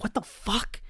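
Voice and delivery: mock outrage, half under breath; woken-up disbelief at the alarm; exasperated disbelief. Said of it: "I'm so pissed".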